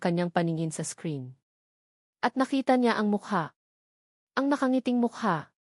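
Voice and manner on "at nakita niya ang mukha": ordinary delivery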